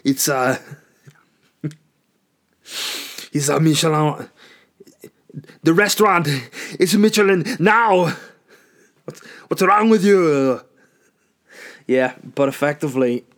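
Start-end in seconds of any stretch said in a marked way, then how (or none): 0.0-10.6 loud, high, hammed-up Austrian accent; Schwarzenegger. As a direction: bellow like a comic Austrian action star. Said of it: "turn into Schwarzenegger".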